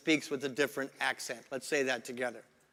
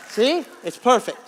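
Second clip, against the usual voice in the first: loud, high, triumphant tailor; joke tailor; 7 semitones higher, 10 dB louder than usual. Acted. Tailor showing a customer the twisted fit, loud comic assurance. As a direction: sell the bad fit with comic conviction.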